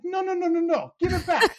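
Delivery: very high-pitched, loud rapid-fire objection; mock frantic protest. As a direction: act out a squealed panic at being robbed. Parent frantically protesting milk grab, retold.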